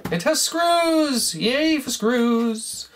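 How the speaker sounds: high, slow cry of delight; excited delight. Real, not acted. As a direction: celebrate finding the screws. Delighted to find screws.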